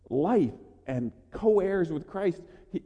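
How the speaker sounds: ordinary delivery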